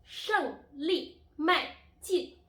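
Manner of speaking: 胜利迈进 loud and high, each syllable falling sharply; emphatic falling-tone drill on 胜利迈进; fourth-tone demo. Shown as a demonstration. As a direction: punch out 胜利迈进 slowly, one falling syllable at a time. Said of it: "these are the four tones".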